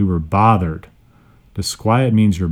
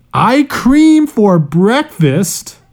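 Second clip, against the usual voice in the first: much higher, slow, drawn-out announcement; excited misread; 11 semitones higher, 4 dB louder, much slower than usual. Real, not acted. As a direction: sing out the title with delight. Misreading review title.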